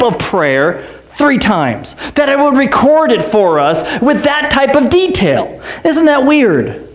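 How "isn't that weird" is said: usual speaking voice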